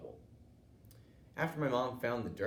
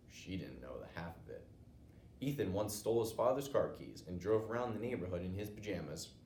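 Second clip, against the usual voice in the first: ordinary delivery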